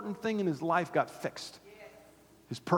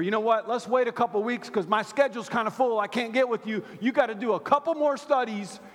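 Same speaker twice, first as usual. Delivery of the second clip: higher, louder put-off voice; mock busy excuse, pitched up and loud; 8 semitones higher, 7 dB louder than usual. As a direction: brush him off, too busy.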